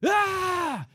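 sudden high, loud "ah" scream; imitated scream. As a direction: imitate a man screaming as he bolts. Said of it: "And he just goes".

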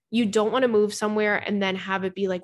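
ordinary talk, nothing marked

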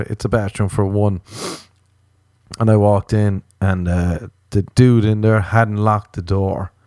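ordinary talk, nothing marked

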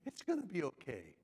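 low, soft reassurance; gently coddle, keeping the voice down; soothing. Acted. Imitating a coddling reply to whiny Elijah, soothing.

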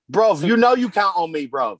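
laughing British "you know you count on me"; laughing mock British accent. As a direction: giggle out a put-on London plea. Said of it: "brush up on our British accents".

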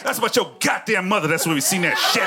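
hammed-up raging kid voice; daughter's furious rant. A daughter cursing out her dad, shouting angrily. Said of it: "She goes".

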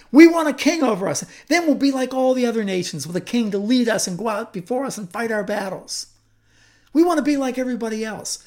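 put-on high and loud, rushing fast like petulant children; whiny demand. The people demanding a king from Samuel, high and whiny. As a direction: plead and push, fast and whiny.

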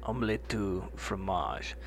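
slow, soft put-on French accent; mock French accent. Shown as a demonstration. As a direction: say it like a Frenchman, slowly. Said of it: "say it again with your accent".

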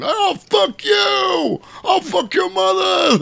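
high, drawn-out outraged screaming; Toll Booth Willie. Retold or imitated. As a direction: scream the insult back, stretched out. Willie insulted, screaming back.

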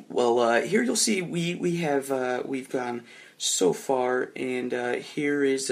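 halting, stammering explanation full of fillers; nervous stalling presentation; flustered presenting. Acted. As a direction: fumble through it, stopping and restarting. Acted flustered map presentation.

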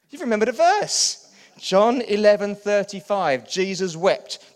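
loud preacher proclaiming the verse; triumphant loud recitation. Shown as a demonstration. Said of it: "let me teach you this one".

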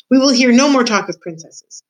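emphatic angry order; stern scolding. A narrator's reading. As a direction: lay down the law to a child. Uncle scolds Meg after clouting her.

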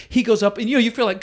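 ordinary talk, nothing marked

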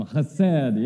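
loud, hearty rabbi voice. Shown as a demonstration. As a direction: boom it out like a rabbi. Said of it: "That wasn't hearty enough. Let's try that one more time".

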